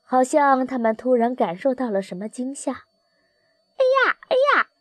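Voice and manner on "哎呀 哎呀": very high yelp of alarm; startled cry. A narrator's reading. Wild geese startled by what is below, high exclamation. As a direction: cry out in sudden shock. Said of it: "他们惊呼道".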